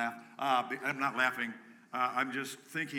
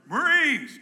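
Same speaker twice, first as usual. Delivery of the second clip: much higher, loud call; 13 semitones higher, 12 dB louder than usual. Real, not acted. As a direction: belt the branch name. Calling out the branches.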